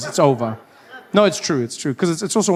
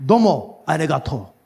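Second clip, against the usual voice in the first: powerful Japanese. Shown as a demonstration.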